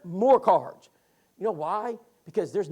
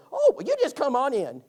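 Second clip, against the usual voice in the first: put-on old lady voice; 7 semitones higher, 8 dB louder than usual. Voicing Bettina inviting in.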